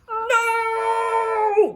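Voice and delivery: long, very high wailing cry; boy's shout. Acted. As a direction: shout it like a dismayed boy. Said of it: "the boy shouts".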